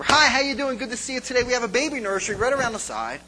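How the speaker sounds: fast, high, loud greeter spiel; perky greeter. Retold or imitated. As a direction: imitate an over-eager usher's rehearsed welcome. Acting second greeter at next door.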